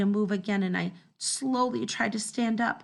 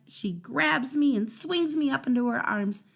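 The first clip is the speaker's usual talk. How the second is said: ordinary delivery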